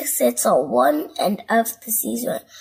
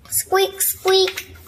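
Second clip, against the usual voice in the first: toy squeak; 8 semitones higher than usual. Acted.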